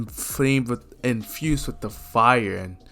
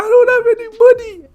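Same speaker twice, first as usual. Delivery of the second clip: loud, very high, rapid scream; frantic falsetto yell; panicked screaming; 11 dB louder, much faster than usual. Acted. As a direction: shriek it as a terrified kid. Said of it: "will continue screaming".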